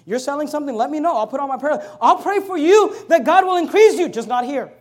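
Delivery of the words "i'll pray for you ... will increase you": rousing blessing. Real, not acted. Pastor blessing business owners.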